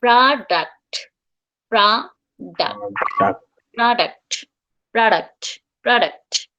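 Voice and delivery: প্রোডাক্ট drawn out slowly, "pradakt"; product demo. Shown as a demonstration. Teacher repeats প্রোডাক্ট slowly for students, "pradakt". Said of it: "products এর কথা যদি বলি".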